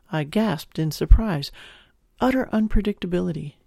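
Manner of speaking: ordinary delivery, nothing marked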